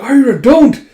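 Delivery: high, loud desperate plea; pleading shout. Acted. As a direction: scream at her to stop. Friend begs her to stop.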